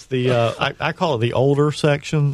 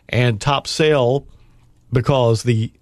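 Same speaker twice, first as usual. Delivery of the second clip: normal talking voice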